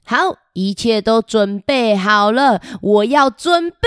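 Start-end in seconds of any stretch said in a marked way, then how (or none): none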